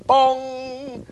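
high, loud mock buzzer; vocal buzzer bong. Judging the guest's celebrity, mock buzzer. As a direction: make a game-show wrong-answer buzzer.

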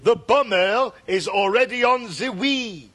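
put-on slow, high-swooping French accent; overdone French accent. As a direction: ham up the mispronounced French accent. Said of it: "if you overdo it".

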